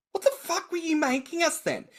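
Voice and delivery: high-pitched mock outrage; exasperated retold demand. Acting out exasperation at mother.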